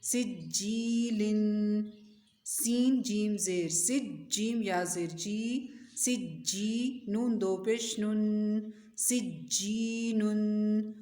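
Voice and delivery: slow, drawn-out letter-by-letter chant; measured tajweed drill with lengthened vowels and nasals; Quran spelling recitation. Shown as a demonstration. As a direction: spell out and join syllables with long held sounds.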